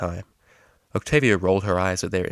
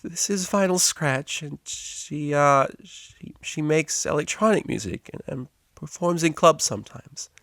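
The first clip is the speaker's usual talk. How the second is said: much higher, halting timid voice; anxious; 8 semitones higher, slower than usual. A narrator's reading. Anxious mare introduces the DJ, timid. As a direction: push through shyly, stumbling. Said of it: "the anxious pony".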